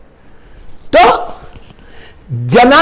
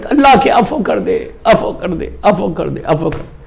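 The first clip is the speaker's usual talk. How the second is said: subdued imitation of tearful pleading, sinking lower; anguished plea; 9 semitones lower, 5 dB quieter than usual. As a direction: repeat the plea, softer each time. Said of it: "تڑپ کے رو رہے". (تڑپتی مگر دھیمی التجا)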